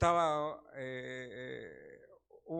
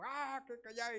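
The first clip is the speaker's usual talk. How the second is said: a hint of a grumpy husband snapping, higher; angry husband; 9 semitones higher, slower than usual. Husband arriving home angry, mock snapping at his wife. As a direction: voice the husband coming home cross.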